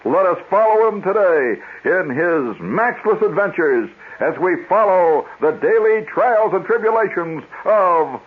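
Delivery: high, dramatic adventure-serial narration, slowing grandly; radio serial announcer. Retold or imitated. Announcer recaps yesterday's episode for boys and girls, grandly dramatic. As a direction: build it up like a children's radio serial. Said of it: "he would follow it up and he'd say".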